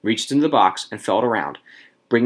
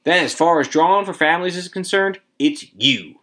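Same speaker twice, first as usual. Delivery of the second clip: higher-pitched, mildly officious explanation; explaining; 7 semitones higher than usual. A narrator's reading. Official explaining the drawing rules, explanatory. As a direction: lay out the rule point by point. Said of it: "said in explanation".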